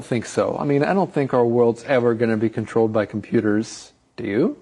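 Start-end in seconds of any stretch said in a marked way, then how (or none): none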